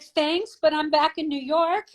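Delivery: high-pitched, mock-grateful brush-off; mock-polite reply. Model turning down a late agency offer, mock-polite. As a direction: decline in a high, sing-song mock thanks. Acts out own belated reply, laughing.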